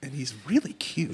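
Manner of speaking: soft unvoiced whisper over the cute dog. Real, not acted. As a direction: whisper it, cooing over a puppy. Cooing over the tiny dog.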